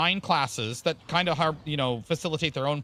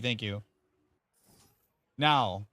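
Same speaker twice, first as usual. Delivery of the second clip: ordinary delivery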